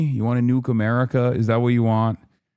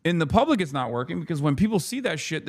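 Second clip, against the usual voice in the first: normal talking voice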